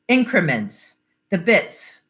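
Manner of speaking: usual speaking voice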